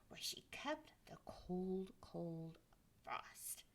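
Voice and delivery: hushed, breathy secret of the freezer; storybook whisper. Acted. Narrator hushing children over the cold frost. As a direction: lean in and whisper it.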